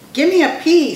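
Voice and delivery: high, loud chant call; cheerleader yell. A narrator's reading. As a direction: call out the letter to rally a crowd. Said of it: "Skunk yelled".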